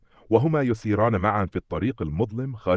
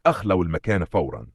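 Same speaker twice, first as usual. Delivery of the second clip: ordinary delivery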